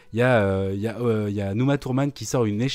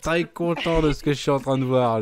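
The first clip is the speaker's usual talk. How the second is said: stern parent voice; 6 semitones higher than usual. Pretend. Mock scolding voice.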